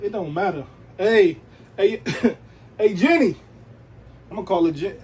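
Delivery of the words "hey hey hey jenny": laughing holler at Jenny; playful holler. Pretend. Vlogger pretends to spot J-Lo, loud laughing holler. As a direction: pretend calling out to a celebrity across the street, higher and louder. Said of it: "Just hollering".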